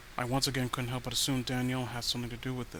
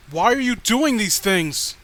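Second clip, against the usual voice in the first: higher, louder, slow frantic yell; panicked shout; 6 semitones higher, 11 dB louder than usual. Acted. Narrator screaming at Danielle over the murder, frantic shout. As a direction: scream the question in horror.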